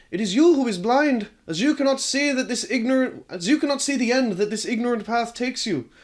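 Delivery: Marty shouting back at his father. Acted. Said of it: "to shout".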